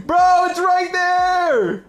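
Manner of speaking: high, slow, shouted desperation; panicking gamer. Real, not acted. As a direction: yell it out, stretched and frantic. Teammate still can't see it.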